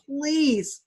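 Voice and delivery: high, loud pleading word; begging. Acted. Drowning boy begs the boat, high pleading cry. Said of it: "Mahmoud begged".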